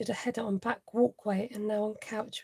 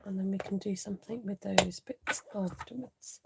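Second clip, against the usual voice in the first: usual speaking voice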